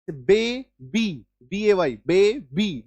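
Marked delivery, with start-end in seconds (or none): 0.1-1.3 बे, बी split into two separate beats; two syllables. Shown as a demonstration. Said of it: "दो बार बोलने पर". 2.1-2.8 बे, बी broken into separate beats; each syllable of बे, बी on its own; two syllables. Shown as a demonstration. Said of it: "दो syllable आप use कर रहे हैं".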